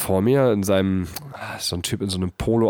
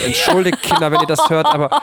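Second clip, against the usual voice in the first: laughing through the apology; giggling, pitched up high; 14 semitones higher, 5 dB louder than usual. Real, not acted. Apologises laughing after cheeky joke.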